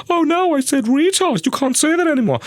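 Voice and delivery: high-pitched mock outrage; mock scandalised objector. Speaker mimics someone objecting to the word, mock scandalised. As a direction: squeal it in a high, put-on voice.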